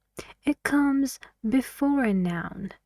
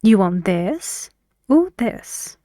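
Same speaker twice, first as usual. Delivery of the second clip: first "this" rises, last "this" falls; either-or question, "this" up then "this" down; rising then falling intonation; 4 dB louder than usual. Shown as a demonstration. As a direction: lift the first "this", pause, drop the second "this". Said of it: "the rising and falling on the words".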